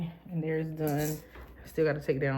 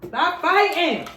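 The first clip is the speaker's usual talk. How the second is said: angry yell; 12 semitones higher, 12 dB louder, much slower than usual. Real, not acted. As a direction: yell it sharply to break up a fight. Scolding kids fighting.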